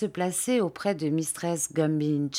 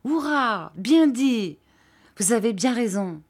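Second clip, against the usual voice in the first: high hurrah, settling into keen agreement; enthusiastic cheering; 5 semitones higher, 4 dB louder than usual. A narrator's reading. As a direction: cheer out loud, delighted to agree. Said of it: "s'écria Ham avec enthousiasme". (acclamation pleine d'enthousiasme)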